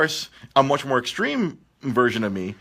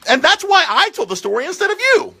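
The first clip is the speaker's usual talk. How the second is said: mock indignant; 3 dB louder than usual. Real, not acted. Host teasing guest.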